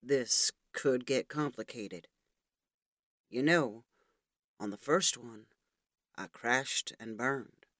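low, slow, quoted movie line; movie quote. A narrator's reading. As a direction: deliver it like the film's hero. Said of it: "began quoting the closing lines from the Top Gun movie".

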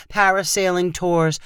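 ordinary delivery

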